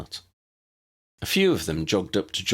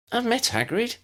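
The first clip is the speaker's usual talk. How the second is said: put-on very high chirpy little girl; chirruping. A narrator's reading. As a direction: chirp it out happily. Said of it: "Lydia chirruped".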